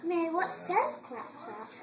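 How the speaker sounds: put-on sing-song, to a small child; bright, playful toddler talk; reading to a toddler. Parent quizzes toddler on animals.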